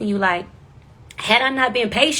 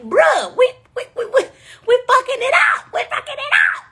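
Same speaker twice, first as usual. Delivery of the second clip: laughing panic. Retold or imitated. Contestant panicking to Arsenio mid-game, high laughing shout. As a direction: shout it in mock despair.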